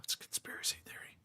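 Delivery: quick hushed mock whisper; conspiratorial whisper. Streamer teasing Ray about a conspiracy theory, mock whisper. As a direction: whisper it fast, like a secret. Mock secret aside to a viewer.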